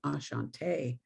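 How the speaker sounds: put-on a light Budapest accent, said softly. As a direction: greet the class gently with a Budapest lilt. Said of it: "you know, Budapest accent".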